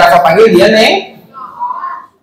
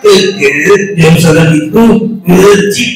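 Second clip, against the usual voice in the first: puppet Afa's voice; 4 semitones lower, slower than usual. Acted. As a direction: lower muffled puppet voice. Puppet Afa lectures back.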